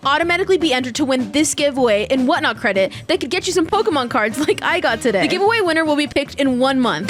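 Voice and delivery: upbeat sponsor read. Acted. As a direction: sell it like a sponsor spot. Reads a sponsor ad.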